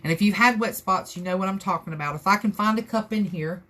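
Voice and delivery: ordinary talk, nothing marked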